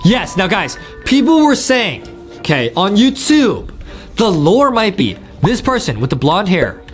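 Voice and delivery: usual speaking voice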